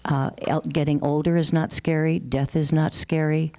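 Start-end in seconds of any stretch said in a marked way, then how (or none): none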